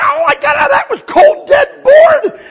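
high, loud, desperate yell at the cop; frantic shouting. Retold or imitated. New convert shouting at the cop. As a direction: shout it like you can't stop. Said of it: "I said".

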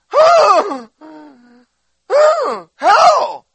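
loud, high-pitched hammed-up wailing; mock crying. Preacher told to walk on the water, acts out loud crying. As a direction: bawl each word like an exaggerated crybaby. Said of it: "I start whining and crying".

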